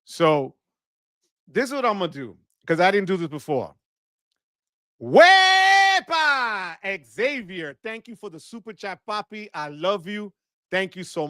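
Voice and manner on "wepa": long, high, loud whoop, stretched out; celebratory holler. Real, not acted. Streamer hailing a super chat donor, celebratory holler. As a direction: belt it out, holding the first syllable.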